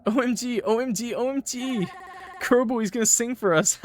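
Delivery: high, gushing fangirl excitement; giddy squeal. Acted. Friend thrilled that someone will sing, giddy high voice. Excited character teases about singing.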